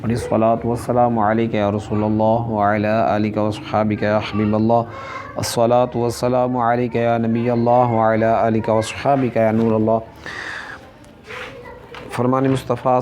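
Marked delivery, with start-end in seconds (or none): 0.0-10.0 measured, chanted Arabic opening prayer; recited invocation. Acted. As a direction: intone the blessing like a formal recitation.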